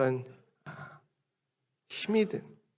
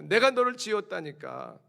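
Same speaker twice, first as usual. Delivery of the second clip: higher, insistent exasperated God; God insisting; 7 semitones higher, 4 dB louder than usual. Acted. God insisting to fearful people, lightly voiced. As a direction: press it home like an exasperated father. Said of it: "하나님은 말씀으로 설명하잖아요".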